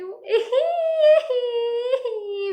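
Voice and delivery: high whimpering sob; crying sound. Shown as a demonstration. Narrator showing how the giant's crying sounds. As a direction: break into a whiny cry. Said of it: "रोते वक्त गोल मटोल राम की आवाज थोड़ी बदल जाती थी".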